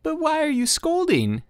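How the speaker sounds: put-on boy protesting to his father, squealing high. A narrator's reading. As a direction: squeal it like a hurt little boy. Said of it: "squealed Petya".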